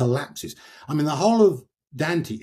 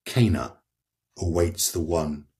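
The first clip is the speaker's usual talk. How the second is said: quiet verse reading; 3 semitones lower than usual. Shown as a demonstration. Reader quoting Francesca's lines to the listener, low and grave. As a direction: read it gravely and understated. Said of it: "She says".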